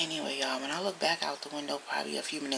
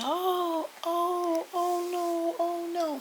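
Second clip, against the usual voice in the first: high wailing mock cry of pain; imitating a crying old man; much slower than usual. Retelling the neighbor crying out in pain, high wail. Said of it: "I hear a man crying and screaming".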